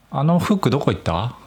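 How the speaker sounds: 「あの服どこ行った？」 with Nagoya accent on 服; casual Nagoya-dialect question; 名古屋弁で「あの服どこ行った？」. Shown as a demonstration. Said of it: "これ名古屋弁".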